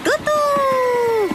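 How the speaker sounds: high, held choo-choo hoot; train whistle toot. A narrator's reading. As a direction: toot like a little steam engine. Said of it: "просигналил Пых".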